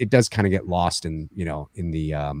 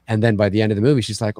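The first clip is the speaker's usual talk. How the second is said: normal talking voice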